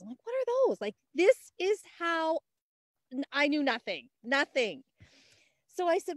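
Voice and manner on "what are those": much higher, louder puzzled question; high incredulous what are those; baffled surprise. Retold or imitated. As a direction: sound totally clueless about it. Retold clueless reaction to oils.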